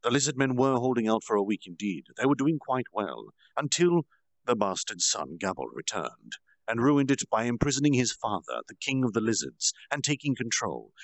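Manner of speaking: plain reading voice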